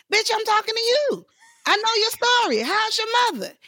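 high, insistent comeback; impatient retort. Retold or imitated. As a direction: set them straight in a high voice. Acts out Whoopi's impatient retort.

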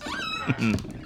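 a sudden high-pitched cackle of delight; gleeful high laugh. Acted. Plotter laughing over his ghost ship ruse, high cackle. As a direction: laugh high and pleased with the scheme.

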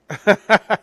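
loud, real laugh; burst of laughter. Real, not acted. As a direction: crack up out loud. Amused by pecan debate.

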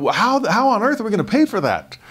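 high, panicked retelling of his own worry; mock alarm, voice jumping up high; freaking out. Presiding bishop retelling his panic over temple costs, high mock alarm. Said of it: "I was freaking out".

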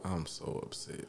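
breathy whisper of dismay; whispered upset. Real, not acted. Whispered reaction.

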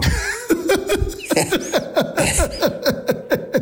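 long laugh, pitched high and breathy; drawn-out amused laugh; high airy laugh. Real, not acted. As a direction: crack up at the wife's line. Laughing at wife's Sudbury joke.

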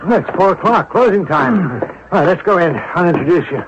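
ordinary talk

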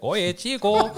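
high, loud Cuban Spanish call. Retold or imitated. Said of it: "we have Chinese Cubans".

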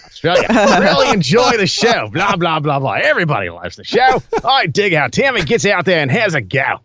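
hammed-up Aussie accent, swooping pitch; Australian accent. Co-host rereading a listener's review for laughs, mock Australian accent. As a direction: lay on a comic Australian twang. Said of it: "read this in an Australian accent".

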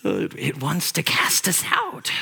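slow, eerie creature voice; Smeagol voice. Acted. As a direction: hiss it out like Gollum, slowly. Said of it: "imagine Smeagol".

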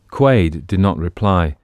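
usual speaking voice

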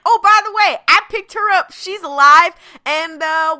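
high, loud breezy offhand tone; mock casual. Boba explaining Fennec to Din, high breezy mock casual. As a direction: toss it off cheerfully. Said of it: "And he's like".

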